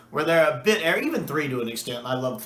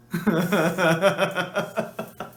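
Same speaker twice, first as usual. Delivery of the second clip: laugh; 4 semitones higher than usual. Real, not acted. Fan laughing at favorite line.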